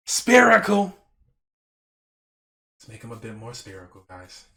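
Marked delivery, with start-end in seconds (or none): 0.1-1.0 high, very loud exclamation; sudden shout. Acted. Painter bursts out mid-instruction to viewers, shouted. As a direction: belt out the word suddenly.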